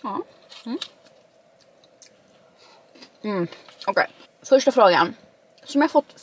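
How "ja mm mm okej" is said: chewing while humming; mumbled through food; mouth full. Real, not acted. Seen 2: takes bite at 2.1-2.4 and chews with closed, full mouth.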